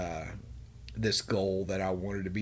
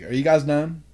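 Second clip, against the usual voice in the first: louder mocking taunt at two men; 4 semitones higher, 6 dB louder than usual. Goading two screaming coworkers to stop, loud mocking taunt. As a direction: egg them on, needling. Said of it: "I'm just agging it on".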